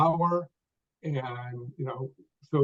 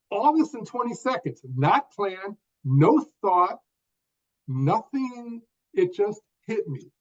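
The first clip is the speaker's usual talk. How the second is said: short phrases broken by pauses, pitched higher; one phrase at a time; 6 semitones higher than usual. Shown as a demonstration. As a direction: speak in clipped little phrases, pausing between each. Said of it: "I'm gonna speak one phrase at a time".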